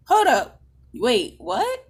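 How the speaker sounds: high, loud disbelief; shocked. Retold or imitated. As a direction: react in stunned shock. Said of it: "I'm like".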